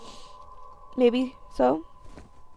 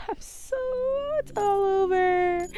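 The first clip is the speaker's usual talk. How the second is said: mock cry; 8 semitones higher than usual. Gamer about to lose, mock crying whimper. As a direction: whimper it as a hammed-up sob.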